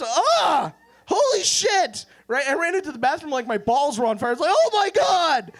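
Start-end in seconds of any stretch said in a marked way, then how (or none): 0.0-2.0 high-pitched scream of horror; shocked yell. Retold or imitated. Comic reliving finding gray hair, shocked yelling. Acts out his shocked discovery. 4.5-5.5 high, loud, drawn-out wail; panicked scream. Retold or imitated. Comic reliving his dash to the bathroom, panicked scream.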